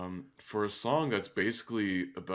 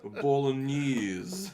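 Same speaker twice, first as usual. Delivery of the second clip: put-on very slow, higher and louder Italian accent on bolognese; mock Italian accent; 7 semitones higher, 4 dB louder, much slower than usual. Naming pasta for his friend in a drawn-out mock Italian accent. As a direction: savor the word like a cartoon chef in a mock Italian accent.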